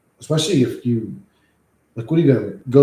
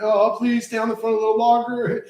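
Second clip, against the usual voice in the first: high, put-on begging voice; mock pleading; 9 semitones higher than usual. Streamer teases scam caller in high mock pleading voice. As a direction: tease him to stay on the line.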